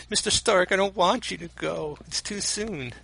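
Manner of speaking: high, pleading and tearful. Acted. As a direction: beg him not to leave. Acting Peter pleading with Stark.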